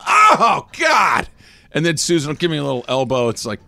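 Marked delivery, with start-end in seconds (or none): 0.1-1.3 high, loud groaning protest; outraged yell. Acted. Fan reacting to an egregious call, outraged yell. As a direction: cry out at a terrible call.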